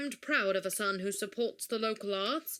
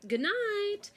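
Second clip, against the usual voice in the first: high, lilting farewell; sing-song goodbye; 11 semitones higher than usual. Acted. Director to departing actor, high singsong goodbye. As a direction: call goodnight in a high singsong.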